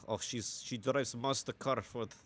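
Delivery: ordinary talk, nothing marked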